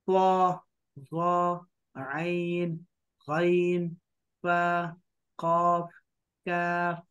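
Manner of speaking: letters drawn out one by one; slow, drawn-out Arabic letter recital; alphabet recitation. Shown as a demonstration. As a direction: chant each Arabic letter slowly, like a Quran teacher.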